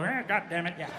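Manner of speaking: soft grumbling reaction; understated old-timer grumble. Acted. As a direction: mutter grudging agreement like an older listener.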